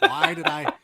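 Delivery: high, loud mock disbelief; playful imitated exasperation. Voices host's regret, mid-laugh.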